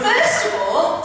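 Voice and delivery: loud and slow through laughter; laughing. Real, not acted. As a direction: still cracking up. Still laughing after the joke.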